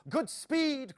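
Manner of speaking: high, loud call of the watch; a ringing send-off shout; cried out. A narrator's reading. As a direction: call them off with a cheer. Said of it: "cried the watch".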